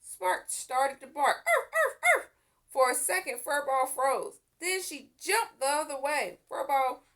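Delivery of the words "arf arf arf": high loud yapping barks; dog barks. A narrator's reading. Puppy barking at the cat in the tree, loud. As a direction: bark like an excited puppy.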